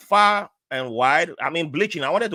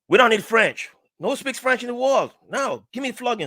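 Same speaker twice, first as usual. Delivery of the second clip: high-pitched, cocky protest; mock-defiant, raised voice; defiant refusal; 9 semitones higher, 4 dB louder than usual. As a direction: refuse it proudly, acting out a defiant pupil. Acting his defiant schoolboy self refusing French.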